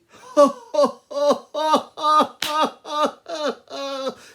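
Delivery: huge, high, loud "ha-ha-ha"; long staged belly laugh at the tiny mouse; mocking lion's laugh. Lion mocks the mouse's offer.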